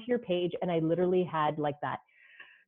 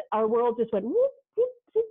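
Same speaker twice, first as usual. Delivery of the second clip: excited whoop; 4 semitones higher, 3 dB louder than usual. Retold or imitated. Excited swoosh sound for sudden connection.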